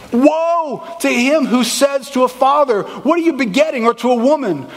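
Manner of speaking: booming preached scripture; preaching at full force. Acted. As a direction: declare it as the Lord from the pulpit.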